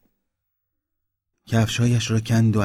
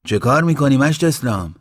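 high, loud question; calling out; 6 semitones higher, 6 dB louder than usual. A narrator's reading. Baba Ali calling to Islam from a hatch, raised voice. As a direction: call the question across the yard.

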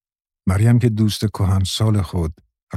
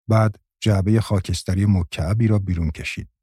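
usual speaking voice

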